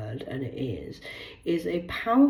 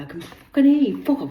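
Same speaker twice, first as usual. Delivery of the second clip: louder and higher, telling them to back off; angry outburst; 10 semitones higher, 10 dB louder than usual. Real, not acted. Yelling at the underworld that she can hear it, angrily. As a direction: snap back hard at the voices.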